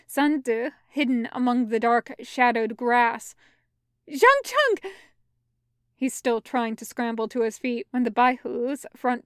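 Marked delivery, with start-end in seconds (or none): 4.1-4.9 high, loud cry of the name; alarmed cry. A narrator's reading. Companion sees him pulled forward, alarmed high cry. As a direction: call out sharply in alarm.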